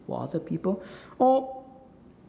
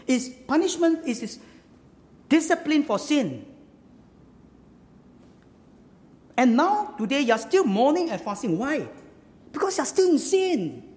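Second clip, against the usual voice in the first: raised pitch, emphatic falling sentence ends; impassioned preaching; 7 semitones higher, 4 dB louder than usual. Acted. Challenging the congregation over unanswered fasting, impassioned preaching. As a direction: preach it hard and high.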